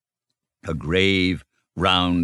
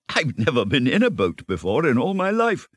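higher-pitched wide-eyed wonder; high, eager confession; 8 semitones higher than usual. A narrator's reading. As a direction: blurt it out with delight. Mole confesses delighted amazement.

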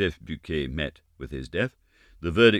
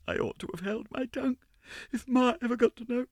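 put-on high tearful girl's voice; a touch of crying, pleading; near tears; 12 semitones higher than usual. Little Giry frets to the older dancers, tearful. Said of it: "little Giry began to cry".

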